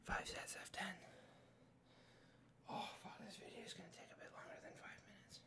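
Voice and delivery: tired whisper, high and strained; breathless whisper. Real, not acted. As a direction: whisper through the burn of exercise. Whispering mid push-ups, tired.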